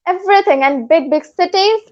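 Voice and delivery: ordinary delivery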